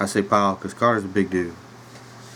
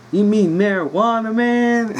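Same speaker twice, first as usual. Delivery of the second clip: stoned drawl; 13 semitones higher, 4 dB louder, slower than usual. Retold or imitated. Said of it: "He says".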